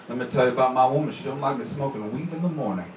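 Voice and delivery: lightly voiced seventies pimp; hint of an old-school pimp comic's patter. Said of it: "he looked like a pimp from the seventies".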